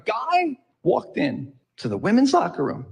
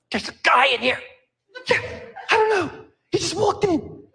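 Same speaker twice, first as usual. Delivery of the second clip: gossipy, higher woman's voice, laughing; chatty woman; 9 semitones higher than usual. Retold or imitated. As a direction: play a woman reporting it with giggly interest. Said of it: "Same words, different intonation".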